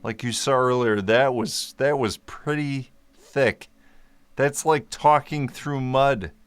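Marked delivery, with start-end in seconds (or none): none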